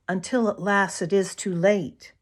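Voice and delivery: ordinary delivery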